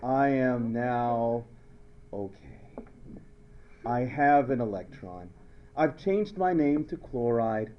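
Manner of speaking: low, slow, contented voice; calmed chloride. Acted. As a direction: speak as if finally at peace. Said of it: "and now she's going".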